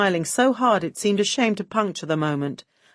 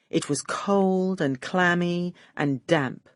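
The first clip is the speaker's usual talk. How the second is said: usual speaking voice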